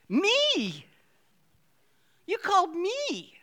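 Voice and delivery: high, astonished cry of me; amazed disbelief. Real, not acted. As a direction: marvel that God chose you. Amazed at being called.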